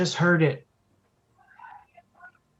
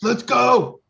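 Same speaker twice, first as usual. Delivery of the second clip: loud, high rallying cry; excited shout; 9 semitones higher, 8 dB louder than usual. Acted. Player rallies the party through the opened door, excited shout. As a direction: call the others on, loud and high.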